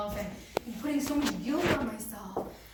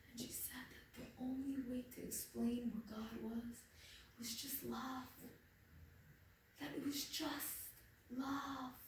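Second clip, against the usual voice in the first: soft; 9 dB quieter than usual. Real, not acted. Soft closing message of love.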